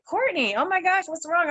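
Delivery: high-pitched worried outcry, retold; acted-out concern, pitched well up; alarmed sister. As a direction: imitate a sister's sudden alarm. Sister alarmed as speaker slumps.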